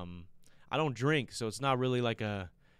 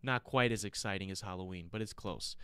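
normal talking voice

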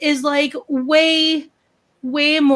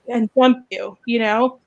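ordinary delivery

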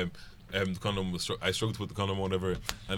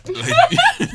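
breaks into high laughter; laughing; 10 dB louder than usual. Real, not acted. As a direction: laugh through the word. Laughing mid-word.

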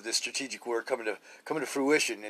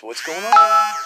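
put-on much higher and louder, a child's voice crying out what's going on; bewildered child; 9 semitones higher, 12 dB louder, much slower than usual. Child asking what's happening when the parent snaps, high and loud. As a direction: cry it out like a confused little kid.

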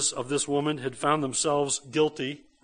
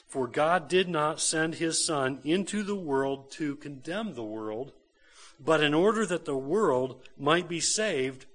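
slow, swelling, emphatic reading of the verse; scripture reading; 3 semitones higher, 5 dB louder, slower than usual. Acted. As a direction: proclaim the verse slowly, building louder. Reads scripture with force.

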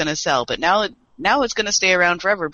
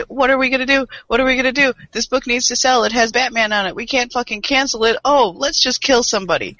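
higher, panicky mock executives; 7 semitones higher than usual. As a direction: whine it like frantic suits. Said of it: "what they do is".

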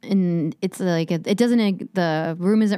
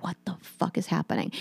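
muttered much softer and a bit lower; hushed disbelief, kept to yourself; under the breath; 3 semitones lower, 9 dB quieter than usual. Retold or imitated. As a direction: say it under your breath, barely projected. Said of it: "under my breath".

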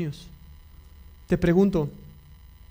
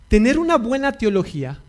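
normal talking voice

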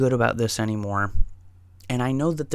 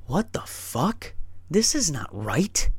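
slow, incredulous question, pitched up; exaggerated bewilderment; 4 semitones higher, slower than usual. Person on mushrooms finding nobody home, slow exaggerated bewilderment. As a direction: draw it out in mock disbelief. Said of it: "sent to this level that's out of the universe".

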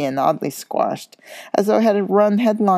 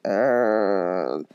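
hesitant er; 8 semitones lower than usual. A narrator's reading. Ron hesitates.

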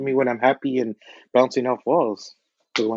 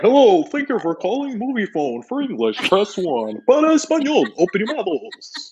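a lightly voiced automated hotline greeting, higher; Movie Phone hotline voice; 8 semitones higher than usual. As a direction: read it like a phone menu recording. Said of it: "I know how to do his voice exactly".

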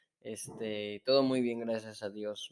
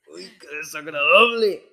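exaggerated, raised mock disgust; 3 semitones higher, 10 dB louder than usual. Cohost teasing partner over a disliked word, mock disgust. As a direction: echo it loudly in playful mockery.

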